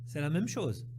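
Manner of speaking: ordinary talk, nothing marked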